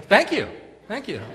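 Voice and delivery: high, small mock voice; sheepish volunteer. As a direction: imitate a bemused kid accepting praise. Voicing baffled Tim in chair.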